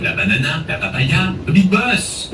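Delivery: a hint of a Minion, playful; mildly high cartoon chatter; light Minion voice. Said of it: "make my voice a little squeaky".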